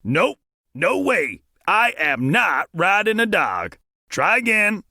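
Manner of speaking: slow, firm no-way refusal; loud refusal. A narrator's reading. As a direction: flatly refuse to ride a dog. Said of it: "Sheriff Dan loudly said".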